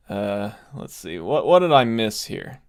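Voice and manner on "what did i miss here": normal talking voice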